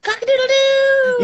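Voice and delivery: high, loud crowing rooster call; like a speak-and-say toy. Acted. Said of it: "You sound like one of those, like, speak and says".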